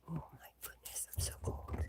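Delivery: breathy unvoiced hush against the cold; cold whisper. Real, not acted. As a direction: murmur it while freezing outside. Shivering in the cold.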